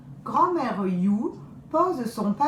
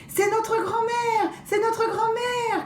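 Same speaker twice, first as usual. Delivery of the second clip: put-on much higher, excited child cry; excited girl's cry. Youngest girl sees grandmother, excited cry. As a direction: cry out with delight like a little girl. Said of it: "crie-t-elle".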